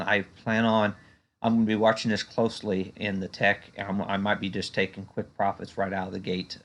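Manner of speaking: ordinary delivery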